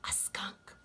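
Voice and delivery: hushed storyteller whisper. A narrator's reading. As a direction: breathe the name for suspense. Suspense before new animal.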